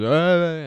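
put-on loud, high drunk "ahh" shout; sudden rowdy holler; drunken yell. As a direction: burst out with a boozy roar. Acting drunk man grabbing friends.

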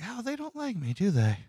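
high, soft put-on aside; mock realization. Voicing own realization about being disliked.

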